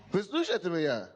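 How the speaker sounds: ordinary talk, nothing marked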